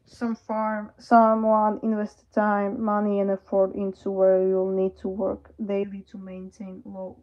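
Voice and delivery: normal talking voice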